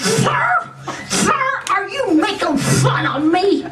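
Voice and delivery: shrill, high indignant squeal at the clerk; mock indignant. Customer squealing at the soda clerk, mock indignant and high. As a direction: act out squealing at him in outrage.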